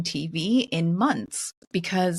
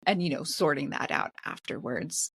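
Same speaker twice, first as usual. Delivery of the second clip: ordinary delivery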